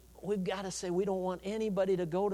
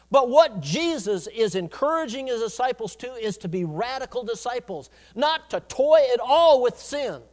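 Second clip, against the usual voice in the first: loud, raised preacher's delivery; forceful preaching; 5 semitones higher, 7 dB louder than usual. Acted. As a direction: drive each point home with punched key words.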